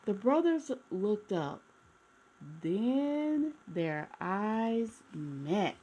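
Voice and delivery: gentle read-aloud storytelling voice; storybook narration. A narrator's reading. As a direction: read it like a bedtime picture book for kids. Reading a picture book aloud.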